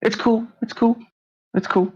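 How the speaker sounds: high, squeaky guard voice; guard backing down. Acted. GM's guard lets Saul through, high voice. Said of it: "He goes".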